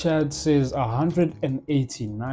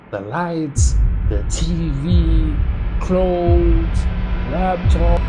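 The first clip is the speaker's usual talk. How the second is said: indignant listing; 3 semitones higher, 7 dB louder, much slower than usual. Retold or imitated. Listing everything the burglars took.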